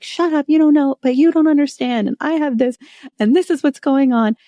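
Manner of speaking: hammed-up indignant protest from a stressed retailer; mock defensive outburst. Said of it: "And they're like".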